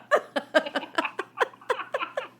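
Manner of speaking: big laugh. Real, not acted. Laughing at the wrong number.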